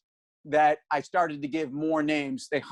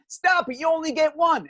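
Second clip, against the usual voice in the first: much higher, brusque New York Italian DJ; impatient DJ with a Guido accent; 11 semitones higher than usual. Retold or imitated. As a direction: cut him off in a Guido accent. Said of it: "with a total, like, Guido accent".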